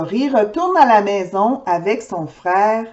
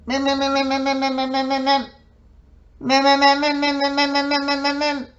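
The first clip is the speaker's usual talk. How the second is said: sputtering engine; 3 semitones higher, faster than usual. Acted. Car engine failing to start.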